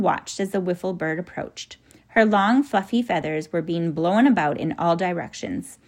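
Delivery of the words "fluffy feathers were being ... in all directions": ordinary delivery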